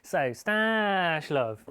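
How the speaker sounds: slow, loud sing-song title; slow drawn-out announcement. Acted. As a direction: stretch out the segment name.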